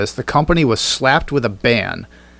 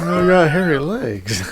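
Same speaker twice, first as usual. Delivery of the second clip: lightly voiced mascot; 5 semitones higher, slower than usual. Joins the mascot bit.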